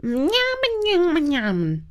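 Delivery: mumbled through a full mouth. A narrator's reading. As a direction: say it with mouth stuffed shut. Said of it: "her mouth was full".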